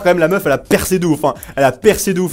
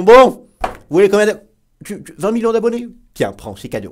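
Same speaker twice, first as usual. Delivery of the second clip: mock YouTube handout; 5 semitones higher, 3 dB louder than usual. YouTube handing its own channels free subscribers, mock generous voice. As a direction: play at casually handing out a gift. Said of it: "des chaînes qui sont créées par YouTube qui font".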